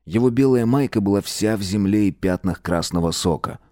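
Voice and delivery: ordinary delivery, nothing marked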